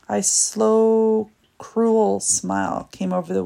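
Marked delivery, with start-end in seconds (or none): none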